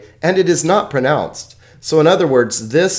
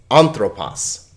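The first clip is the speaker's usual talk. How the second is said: ANTHROPOS with the first syllable stressed; anthropos, emphasis on the opening syllable; stress on accented syllable; 3 semitones lower, slower than usual. Shown as a demonstration. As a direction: lean on AN at the start of anthropos. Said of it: "syllable that has the accent over it will get the emphasis".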